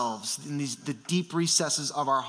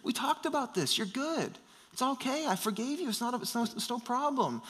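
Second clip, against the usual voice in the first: soft, higher-pitched comforting voice; reassuring dad; 6 semitones higher, 7 dB quieter than usual. Retold or imitated. Dad to crying son in his room, softly reassuring. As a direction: gently soothe an upset child, light and hushed.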